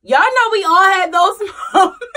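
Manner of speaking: loud, high, breaking into laughter; real laughing. Real, not acted. Speaker to viewers about moments we all had, laughing. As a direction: laugh out the words at a shared weakness.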